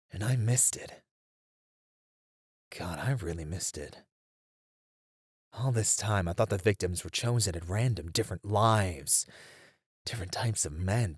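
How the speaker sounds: a hushed, regretful realization; quiet self-reproach. Acted. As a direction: murmur it to yourself, crushed by the mistake. Realizing own mistake, self-reproach.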